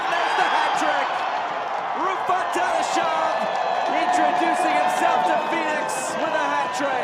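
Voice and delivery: loud, thrilled hat-trick call; excited goal call. Real, not acted. Commentator calls a hat trick.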